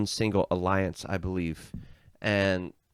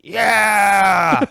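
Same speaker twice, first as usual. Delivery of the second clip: much higher, louder drawn-out cheer; crew cheering; 11 semitones higher, 11 dB louder than usual. Retold or imitated. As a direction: roar agreement as the mutinous crew. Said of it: "they're all like".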